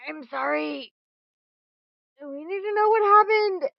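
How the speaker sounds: loud, high and insistent; exasperated outburst. Acted. GM acts Jenkins arguing.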